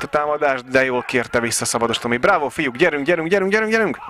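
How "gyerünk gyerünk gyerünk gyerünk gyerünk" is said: rising urgent chant to the team; excited cheering. Real, not acted. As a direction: urge them on, louder and higher. Commentator cheering his team on.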